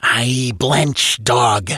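hissing, menacing sorcerer's voice; serpent-like hiss. Acted. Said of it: "The voice was like a hiss of a giant serpent".

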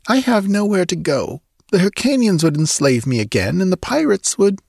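sulky. Acted. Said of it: "amended rather sulkily".

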